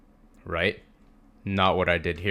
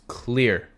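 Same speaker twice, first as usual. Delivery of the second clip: "clear" drawn out slowly for emphasis; stress on clear; 3 semitones higher than usual. Real, not acted. Trader teaching viewers what a valid setup is, stress on "clear". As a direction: lean on "clear" and stretch it. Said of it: "I put emphasis on that word".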